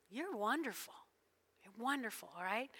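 ordinary talk

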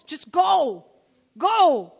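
loud, sharp order of "Go."; commanding shout. Acted. God ordering a hesitant Moses, shouting loud.

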